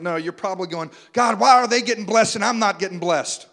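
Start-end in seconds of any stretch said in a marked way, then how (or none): 1.1-3.5 whiny jealous prayer. Retold or imitated.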